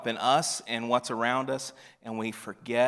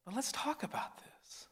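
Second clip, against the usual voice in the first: soft, higher whispered invitation; 11 semitones higher, 13 dB quieter than usual. Acted. Jesus inviting doubting Peter to talk, whispered.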